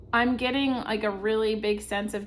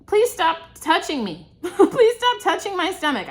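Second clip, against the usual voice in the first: higher, louder insistent plea; firm protest; 8 semitones higher, 7 dB louder than usual. Pretend. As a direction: firmly tell them to stop. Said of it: "It's okay to say".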